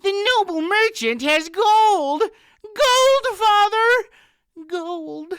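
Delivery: very high, loud near-shriek about gold; greedy shrill outcry. A narrator's reading. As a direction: beg your father, almost crying for the gold. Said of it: "Anhelda protested".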